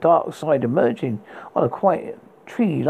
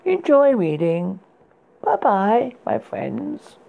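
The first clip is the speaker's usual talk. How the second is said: horror host; 3 semitones higher, slower than usual. Acted.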